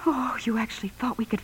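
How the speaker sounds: hushed, breathless disbelief; incredulous whisper. Acted. Laurie whispers in disbelief at Jim's plan. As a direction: whisper it, stunned by him. Wife reproaches husband.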